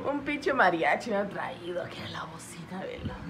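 whispering. Real, not acted. Complaining under her breath.

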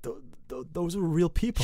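shaken up, imitated. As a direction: stumble into it as if shaken. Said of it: "She is so shaken up".

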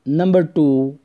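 Pakistani English teacher accent. Real, not acted. Teacher opening a topology lesson.

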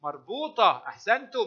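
ordinary talk

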